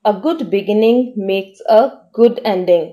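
slow, careful dictation pace; teacher reading an example sentence very slowly. Shown as a demonstration. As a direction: read it out slowly for learners to follow. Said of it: "इसको sentence में use करके देखते हैं".

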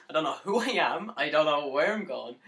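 loud cry of lost confusion; anguished, forceful and loud; despairing outburst. Acted. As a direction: burst out loudly in despair. Despairing outburst about being lost.

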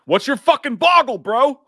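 hammed-up movie line; mock movie quote. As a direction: bark it like a cocky cop.

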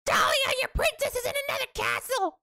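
very high squawking yell; piercing, high-pitched cartoon voice; Toad. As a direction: screech like a pleading cartoon mushroom. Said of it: "Toad yelling at me".

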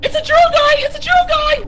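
very high-pitched warning yell; alarmed shriek. Real, not acted. Player warning team about a monster, alarmed shriek.

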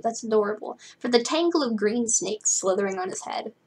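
ordinary delivery, nothing marked

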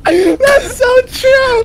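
high, loud laughing cry; slow, cracking-up shriek. Real, not acted. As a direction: squeal it out between laughs. Player cracking up at reply.

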